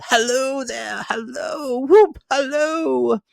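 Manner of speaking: very high, loud, slow whoop; giddy hollering; joyful whooping. A narrator's reading. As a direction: call out wild with joy. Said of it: "frisked into the sitting room".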